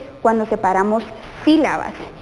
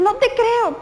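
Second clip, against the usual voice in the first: no te creo laughed out, much higher; teacher laughing no te creo with exclamation; laughing disbelief; 10 semitones higher, slower than usual. Shown as a demonstration. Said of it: "si lo escribes con los signos".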